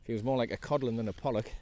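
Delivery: ordinary talk, nothing marked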